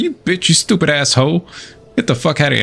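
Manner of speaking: mildly heated, without extra volume; retold flip-out. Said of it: "I just flipped out".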